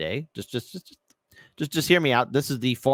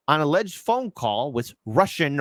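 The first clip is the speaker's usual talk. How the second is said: ordinary delivery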